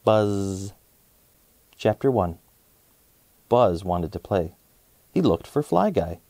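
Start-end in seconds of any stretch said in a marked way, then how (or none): none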